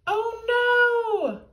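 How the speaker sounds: high, loud dismayed cry; alarmed cry. A narrator's reading. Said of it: "cried George".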